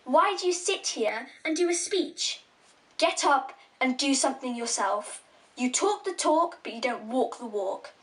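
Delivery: ordinary delivery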